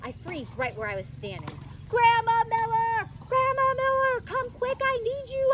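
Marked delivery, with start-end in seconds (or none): 1.9-5.5 much higher and louder, desperate calling; panicked shout. A narrator's reading. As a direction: scream for grandma to come quick. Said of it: "I shouted".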